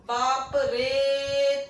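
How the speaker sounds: बाप रे with रे sung out very long; pluta vowel demo. Shown as a demonstration. Teacher singing out बाप रे to show the three-beat vowel. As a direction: stretch रे of बाप रे for three beats, half sung. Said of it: "इसको हम बोलते हैं तो जैसे".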